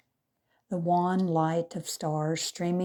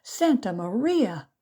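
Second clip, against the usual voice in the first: tearful cry; 5 semitones higher, 4 dB louder than usual. A narrator's reading. Maria cries out in fear.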